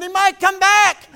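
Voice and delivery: high, loud, drawn-out shout; panicked yell. Retold or imitated. Husband still fleeing a snake yells to wife, panicked holler.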